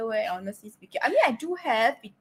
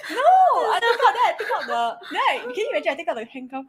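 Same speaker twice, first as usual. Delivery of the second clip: laughing; 5 semitones higher, 5 dB louder than usual. Real, not acted. Laughing while telling joke.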